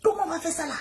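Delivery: COMMENT flung up high in alarm; high panicked cry. Retold or imitated. Niece panicking over the aunt's news, high alarmed cry. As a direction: wail the question in panic.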